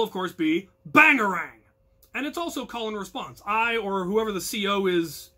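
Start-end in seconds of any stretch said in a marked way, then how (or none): 0.9-1.6 loud punchy shout; battle cry. Shown as a demonstration. Commander shouts the auxiliary's battle cry. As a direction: punch it out like a war whoop. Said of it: "The battle cry of the auxiliary".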